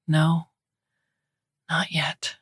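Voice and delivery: hushed whisper to herself. Acted. Tired mother telling herself during night feeds, whispered. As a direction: breathe it under the breath.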